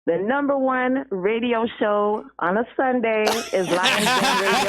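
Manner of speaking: big up the show with pride; proud shout-out. Real, not acted. Caller plugs the show.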